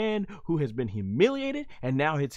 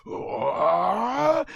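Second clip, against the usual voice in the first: mock battle yell; 6 semitones higher, 4 dB louder than usual. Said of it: "starts yelling".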